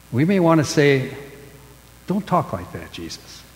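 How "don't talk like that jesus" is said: dropping low and soft; a hushed, pleading protest to Jesus; soft, low plea. Pretend. Preacher voices hushed plea to Jesus.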